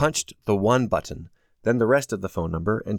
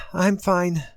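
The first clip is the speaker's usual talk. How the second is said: sniffling; 8 semitones higher than usual. A narrator's reading. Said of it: "she sniffled again".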